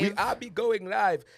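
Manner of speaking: put-on high, loud Haitian accent through a laugh. Host repeating the joke, laughing in a Haitian accent. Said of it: "my little Haitian accent".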